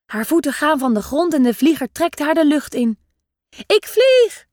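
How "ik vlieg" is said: much higher, louder delighted cry; a girl shrieking with joy as she is lifted off the ground; excited shout. A narrator's reading. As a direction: ecstatic child yelling. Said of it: "roept Eefje".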